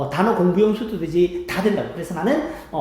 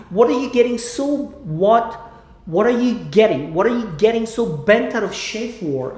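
slow, deliberate English repetition; slow drill; much slower than usual. Shown as a demonstration. Teacher drills Chandler's line for students. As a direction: stretch each word slowly. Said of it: "slow repetition drill".

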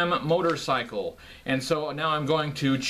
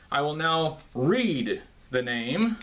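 ordinary delivery

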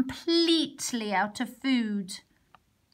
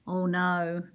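ordinary delivery, nothing marked

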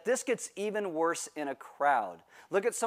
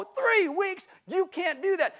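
put-on indignant teen; 14 semitones higher, slower than usual. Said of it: "And I go".

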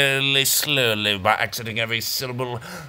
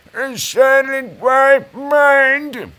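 slow, loud, high-pitched drawn words; silly high exclamation; 11 semitones higher, 8 dB louder, much slower than usual. Pretend. Host landing the joke about the Y, silly high voice. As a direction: squeal each word out long and loud.

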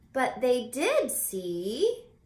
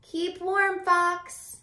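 much higher, louder bright farewell; cheery goodbye; 9 semitones higher, 6 dB louder, much slower than usual. Acted. Charles wishes Fox well, high cheery farewell. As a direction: sing out a caring goodbye.

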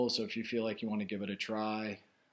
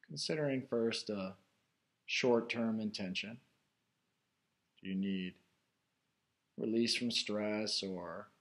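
guided meditation; 3 semitones higher, 5 dB louder than usual. Real, not acted. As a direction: guide listeners inward, unhurried, phrase by phrase. Leads a guided meditation after chat.

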